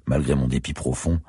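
plain reading voice

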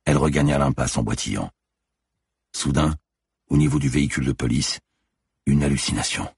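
ordinary delivery

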